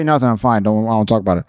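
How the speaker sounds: low, brushed-off mumbled reply; acted withdrawn boyfriend, pitched well down; sullen boyfriend. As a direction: shut the conversation down, low. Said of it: "Did he say".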